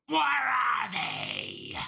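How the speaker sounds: very slow, stretched yell; drawn-out shouted demand. Acted. Cop burning leaves to make the killer talk, drawn-out shout. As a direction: bellow it, dragging each word out.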